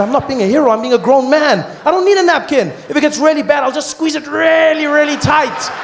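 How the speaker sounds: very high, loud protest; a high, loud yelled refusal of the napkin; mock indignant shout. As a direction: insist like an offended grown man. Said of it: "I said".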